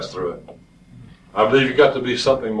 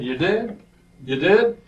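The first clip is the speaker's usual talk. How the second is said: retold surprise, higher rising question; surprised; 6 semitones higher, slower than usual. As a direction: echo a friend's baffled surprise, pitched up. Friend surprised at what he missed.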